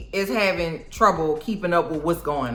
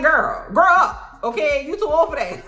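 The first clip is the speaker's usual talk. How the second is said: exasperated scold; 6 semitones higher than usual. Retold or imitated. Scolding childish Agatha.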